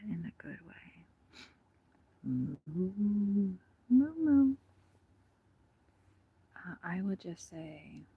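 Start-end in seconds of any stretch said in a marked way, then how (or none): 2.2-4.5 loud mooing, last moos much higher; cow impression. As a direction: call out to the cows with moos. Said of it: "I'm fixing to do my cow impression".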